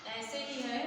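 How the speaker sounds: ordinary delivery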